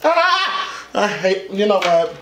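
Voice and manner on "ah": short high squeal of emotion; high cry. Real, not acted. Reactor hates feeling this, high cry.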